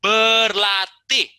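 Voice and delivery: loud, high, slowly stretched berlatih; rally the class with one big word; booming drawn-out call to practise; energetic call. Shown as a demonstration. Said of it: "mari kita mencoba".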